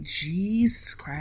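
breathy whispered "Jesus Christ"; whispered disbelief. Retold or imitated. Retold shock at the quote.

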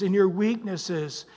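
usual speaking voice

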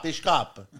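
ordinary talk, nothing marked

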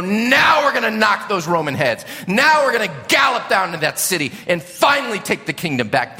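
loud, excited battle cry; rally the crowd for the charge; pumped-up crowd shouting about taking the city; eager crowd. Retold or imitated. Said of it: "they know".

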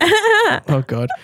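high exasperated wail; exasperated cry. Real, not acted. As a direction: wail in fake despair. Reacting to her taunt.

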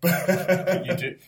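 high, loud laugh through the words. Real, not acted. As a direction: blurt it out while laughing. Laughing reply to cohost.

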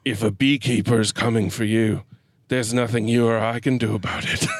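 a touch of mock gravitas, pitched low; low, solemn movie-line delivery; Jeremy Irons. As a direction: lightly imitate a grave, portentous actor. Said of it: "with full Irons gravitas".

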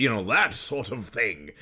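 theatrical mid-Atlantic impression; old theater-actor accent; Hans Conried. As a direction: put on a grand stage voice. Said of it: "especially Hans Conried, like".

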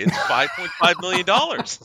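loud, high, excited answer; excited shout. Real, not acted.

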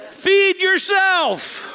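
high, slow, loud scolding snap; exasperated wife. Acted. Wife telling her starving husband to fend for himself, loud high scold. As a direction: bark it at him like a fed-up wife.

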